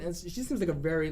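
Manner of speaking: normal talking voice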